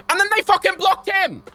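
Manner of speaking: high, loud outraged cry; furious disbelief. Real, not acted. Bank blocked Dan's own transfer.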